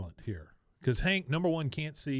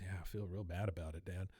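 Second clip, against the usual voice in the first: retold remorse; 5 semitones lower, 11 dB quieter than usual. Acting a remorseful son's confession.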